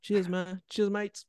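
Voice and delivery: high, casual British bloke voice; breezy British accent, cheers mate. Pretend. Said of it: "Warum plötzlich Akzent?". (lässiger britischer Akzent)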